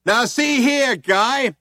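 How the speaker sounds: loud, high hailer voice; through a loud hailer. A narrator's reading. Cop addressing them through the loud hailer, loud. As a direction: blare it like a megaphone cop. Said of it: "said the voice from the loud hailer".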